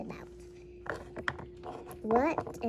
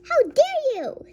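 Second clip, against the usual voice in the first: high, loud indignant cry; outraged; 11 semitones higher, 8 dB louder than usual. Pretend. Food truck owner to customer, outraged. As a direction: cry it out in offence.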